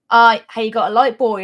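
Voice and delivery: loud, broad Norfolk impression of a brother; imitated broad Norfolk greeting; broad Norfolk accent. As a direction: put on a thick Norfolk accent. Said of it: "he is quite broad".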